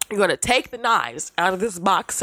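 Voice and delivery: ordinary talk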